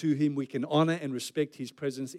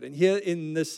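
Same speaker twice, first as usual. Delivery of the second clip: ordinary delivery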